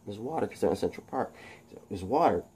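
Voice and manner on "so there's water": usual speaking voice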